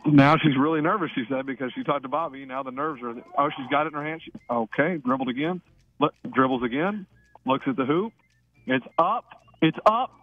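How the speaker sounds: live free-throw commentary, building to each shot; playful play-by-play. Acted. Radio host calling a caller's free throws, mock sportscaster. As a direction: call every dribble like a courtside announcer.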